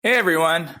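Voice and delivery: ordinary talk, nothing marked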